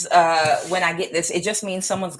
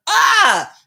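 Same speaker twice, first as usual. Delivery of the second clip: short sharp high shriek, much louder; sudden shriek; 14 semitones higher, 10 dB louder than usual. Real, not acted. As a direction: blurt out a piercing yelp. Outburst after mocking quote.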